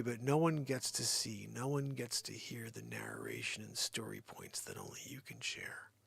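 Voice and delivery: whispered lyric, very soft; whispering. Acted. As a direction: breathe the words in a hush.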